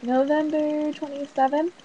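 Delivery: high, rising unsure question. Retold or imitated. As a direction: ask it like you're guessing. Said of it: "so it's like".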